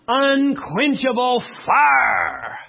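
high, loud, drawn-out Southern preacher cry; southern drawl. Shown as a demonstration. Preacher hollering the phrase in a Southern drawl. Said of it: "I just have to say it with a southern drawl".

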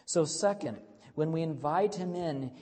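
ordinary talk

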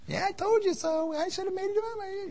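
high, teasing "told you so" wife voice; imagined wife gloating. Retold or imitated. Preacher voicing his wife gloating at him, high mock I-told-you-so.

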